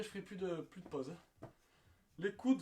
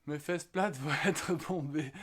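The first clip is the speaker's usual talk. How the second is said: laughing; 6 semitones lower, 4 dB louder than usual. Real, not acted. Reads a comment, laughing.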